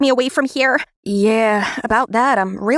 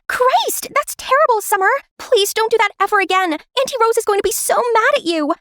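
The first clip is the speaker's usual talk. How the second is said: much higher, appalled; shocked scolding; 9 semitones higher than usual. Acted. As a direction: gasp at the prank and plead. Sister confesses prank.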